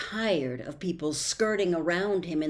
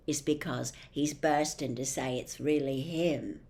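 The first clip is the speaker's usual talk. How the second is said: plain reading voice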